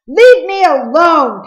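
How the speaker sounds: a sudden loud, high, slow outburst; angry blurt. A narrator's reading. Peter lashing out at Cole, a loud high blurt. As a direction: shove the words out, loud and high. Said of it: "Peter blurted".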